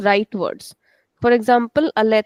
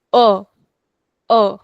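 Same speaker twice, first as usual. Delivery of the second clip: louder A pushed up from the stomach like a burp; grave burp-like A; 3 semitones lower, 5 dB louder than usual. Shown as a demonstration. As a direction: belch out a grave A from the belly. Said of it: "Burping sound".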